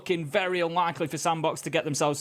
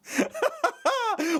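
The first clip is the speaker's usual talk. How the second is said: a high burst of laughter; real laugh at the joke. Real, not acted. Co-caster laughing at the amnesty line.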